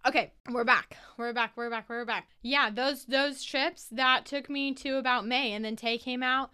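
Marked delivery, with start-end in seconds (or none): none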